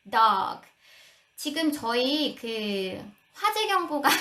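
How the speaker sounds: normal talking voice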